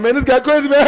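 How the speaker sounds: hyped-up amazement at the donor; excited disbelief. Retold or imitated. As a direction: marvel at him out loud.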